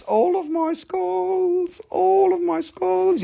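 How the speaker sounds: high, very drawn-out words; playful high wail. Pretend. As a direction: stretch it out high and silly. Playful chant in put-on voice.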